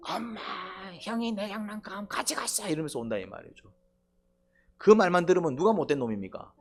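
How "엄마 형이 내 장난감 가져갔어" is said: put-on higher, softer tattling child; little brother tattling to mom, higher whiny kid. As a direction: tell on your big brother to mom. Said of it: "엄마한테 뽀로로 뭐라고 옵니까".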